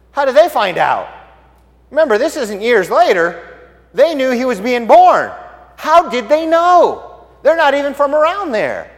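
high, loud, incredulous questioning; emphatic amazement. Real, not acted. Preacher on Gentiles knowing of the birth, amazed and emphatic. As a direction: exclaim it as a baffling puzzle.

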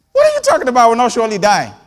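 high, loud, incredulous; shocked outcry. Real, not acted. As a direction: cry out in disbelief. Outraged disbelief at serpent.

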